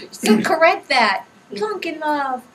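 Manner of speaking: loud, high, through laughter; real laughter spilling into the words; amused, laughing. Real, not acted. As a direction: still laughing as you make the correction. Amused correction after laughing.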